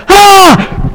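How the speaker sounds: high startled mock yell. Teacher retelling being startled by student, startled yell. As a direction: yelp as if grabbed from behind. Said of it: "and I went".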